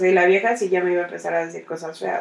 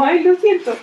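mock apology; 10 semitones higher, 4 dB louder than usual. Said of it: "Y yo como".

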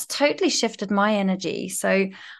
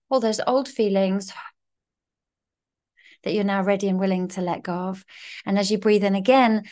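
calm guided breathing voice. Shown as a demonstration. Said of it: "guides breathing exercise".

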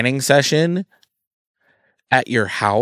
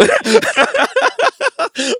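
loud high laugh; high burst of laughter; 4 dB louder than usual. Real, not acted. Laughing at own story.

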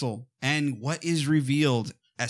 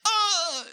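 high-pitched falling-victim cry; imitated cry. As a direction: mimic a man crying out as he falls. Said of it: "makes a noise".

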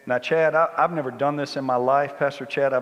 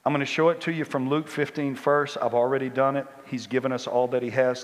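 normal talking voice

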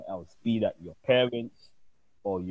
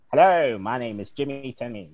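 put-on posh old Hollywood voice, louder; Mid-Atlantic accent; 4 semitones higher, 9 dB louder than usual. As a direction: put on a clipped transatlantic announcer voice. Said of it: "the kind of like".

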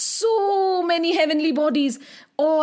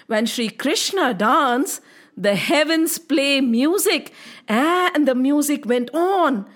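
plain reading voice

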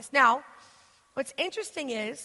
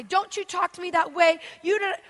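higher, louder stern scold; mock scolding; 5 semitones higher, 5 dB louder than usual. Parent mimicking a harsh scold she avoids, higher and louder. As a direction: snap at a child to obey. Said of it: "I can't say".

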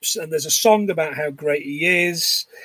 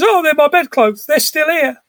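mock delight; 9 semitones higher than usual. Scrooge delighted on waking.

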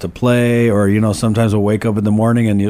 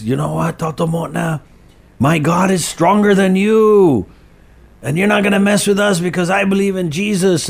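defiant rebuke to a spirit; 8 semitones higher than usual. Retold or imitated. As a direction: stand up to the spirit with a high, slowed challenge. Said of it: "my party goes".

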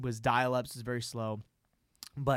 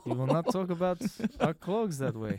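put-on Russian accent; 3 semitones higher, 3 dB quieter than usual. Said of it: "Vladimir Putin is like".

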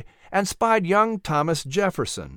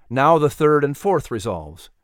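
ordinary delivery

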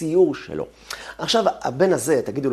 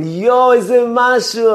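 forced praise; 11 semitones higher, 10 dB louder, slower than usual. Retold or imitated. Family forcing praise for weak singer.

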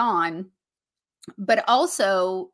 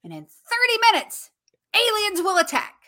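hyped, higher and louder countdown; excited; 6 semitones higher, 6 dB louder than usual. Retold or imitated. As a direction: build the panic for the kids. Mom hyping alien threat.

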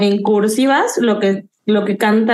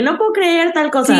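mock disbelief; 6 semitones higher than usual. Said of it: "subes uno como el resumen".

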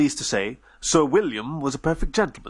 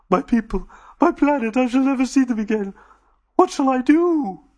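high, loud wailing lament; Dodo despairing; 12 semitones higher, 4 dB louder than usual. Acted. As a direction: plead to nobody.

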